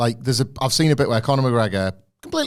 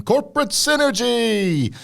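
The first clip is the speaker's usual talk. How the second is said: high, drawn-out singsong proclamation; slow, over-the-top high-pitched jingle delivery; mock corporate slogan; 11 semitones higher, slower than usual. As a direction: trumpet the buzzword with mock enthusiasm. Mock slogan for company crossover.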